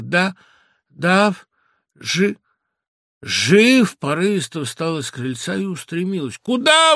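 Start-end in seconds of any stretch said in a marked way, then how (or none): 0.0-4.0 "Да, да, жи-, жив" in gasped, separate bursts; with terrible effort. A narrator's reading. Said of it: "с страшным усилием проговорив". 6.4-6.9 loud, high, stretched cry; exclamation. A narrator's reading. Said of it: "воскликнул я".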